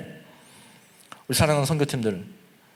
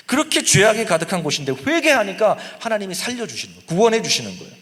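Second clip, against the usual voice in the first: usual speaking voice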